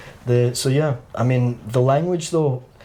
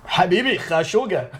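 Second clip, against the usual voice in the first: higher, blunter Iraqi Arabic; 6 semitones higher, faster than usual. Retold or imitated. Contrasting Iraqi with Lebanese for a friend, blunt Iraqi voice. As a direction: say it rougher, Iraqi style. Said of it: "whereas Iraqi, bro, we are".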